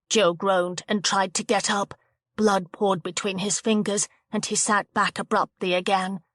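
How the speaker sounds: plain reading voice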